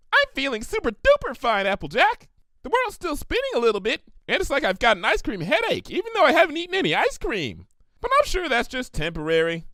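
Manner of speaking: squeaky high Pinkie voice under a disguise; bubbly, very high and bright; mismatched high voice. Acted. Pinkie disguised as Rainbow Dash chirps to Applejack, very high. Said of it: "clearly mismatched voice".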